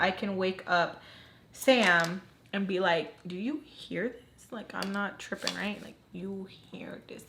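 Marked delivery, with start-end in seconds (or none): 3.3-7.2 secretive hush, sinking into whisper. Retold or imitated. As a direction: check with a friend under your breath. Waking friend without being overheard.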